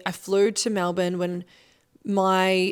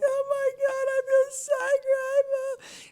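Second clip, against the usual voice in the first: much higher, crying through the words; retold sobbing; 4 dB quieter than usual. Coach reliving meeting her clients' clients in Egypt, crying voice. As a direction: imitate herself bawling with gratitude. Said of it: "like, literally sobbing".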